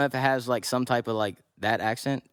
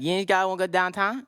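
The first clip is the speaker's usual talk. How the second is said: higher-pitched Pittsburgh yinzer question, words run together; Pittsburgh accent; 7 semitones higher than usual. Shown as a demonstration. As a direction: demonstrate Pittsburgh slurring, endings dropped. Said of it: "you don't finish your words".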